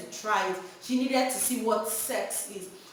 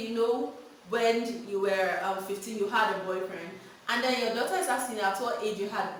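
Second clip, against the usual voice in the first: ordinary talk, nothing marked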